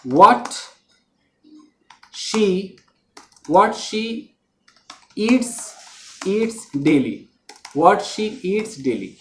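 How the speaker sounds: slow dictation. Shown as a demonstration. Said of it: "तो यहाँ पर जो आप देख रहे हैं what she eats".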